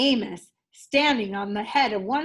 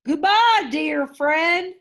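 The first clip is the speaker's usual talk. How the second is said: rumbling whale; 6 semitones higher, 8 dB louder, slower than usual. A narrator's reading. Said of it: "rumbled Boris".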